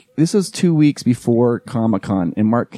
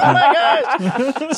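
very high, drawn-out mock squeal; thrilled squeal; much slower than usual. Mom learns her smart friend watches Star Trek, thrilled squeal. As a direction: imitate a delighted fan, pitch shooting up. Acts out mom's delighted discovery.

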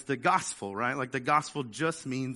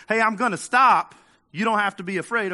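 louder and higher, calling out a window; bus driver calling out; 5 semitones higher, 9 dB louder than usual. Retold or imitated. Bus driver calls to someone in the road, loud and high. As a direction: yell it out to the person in the road. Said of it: "bus driver leans out the window and says".

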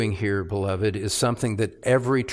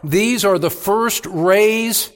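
higher and louder, driving sermon voice; preaching at full force; 8 semitones higher, 5 dB louder, slower than usual. Acted. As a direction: preach it hard, rising with conviction.

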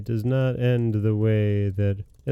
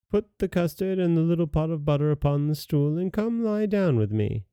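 wolf posing as grandmother, higher voice; lightly voiced disguised wolf; 7 semitones higher than usual. Wolf in bed luring the girl, lightly disguised voice. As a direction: lightly put on a coaxing granny pitch.

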